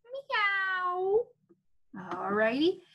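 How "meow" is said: high kitten meow. Shown as a demonstration. Teacher leading first graders in animal sounds, high meow. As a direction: mew it like a cartoon cat.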